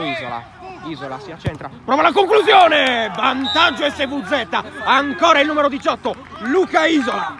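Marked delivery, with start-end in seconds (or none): 1.8-7.4 rising shout at the shot; excited goal call. Real, not acted. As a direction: lift the voice as the goal goes in. Goal call in live commentary. (telecronaca concitata da gol)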